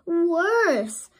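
sudden loud high blurt. A narrator's reading. Little fish blurting to Ariel, high and loud. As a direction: burst out with it, panicked. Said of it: "Flounder blurted out".

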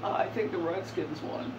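easygoing hint of Clinton answering a sports question; Bill Clinton voice. Gamer voices Clinton answering a friend about football, lightly. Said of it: "Hey, hey, Bill".

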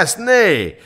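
high, loud angry yell; guard's full-voiced shout; roaring. A narrator's reading. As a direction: roar it at the peasant. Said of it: "ordított a hajdú".

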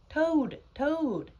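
high, loud calling shout. A narrator's reading. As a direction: shout his name to rouse a friend. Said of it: "shouted Frog".

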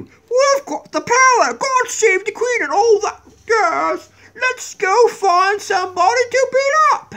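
high, slow, shouted English villain crowing; mock Illuminati voice. Mocking the Illuminati for the viewers, a high slow shouted boast. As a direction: gloat like a cartoon secret society. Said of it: "I don't know why they talk like that".

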